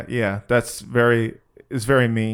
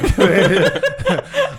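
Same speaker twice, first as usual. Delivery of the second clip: high laugh. Real, not acted. Laughing before the impression.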